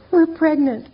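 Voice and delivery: telling the group therapists with joy; proud announcement. Retold or imitated. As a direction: deliver the big news proudly.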